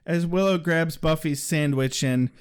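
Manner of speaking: ordinary delivery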